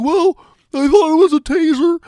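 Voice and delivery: high, loud, whiny mock voice; mock dumb excuse. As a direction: play a clueless officer making an excuse. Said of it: "Don't be like".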